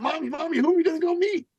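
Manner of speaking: put-on high, eager child asking mommy; excited little boy. Little son asking his mother who they'll meet, high and eager. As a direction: bounce up like an excited kid. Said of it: "got excited and said".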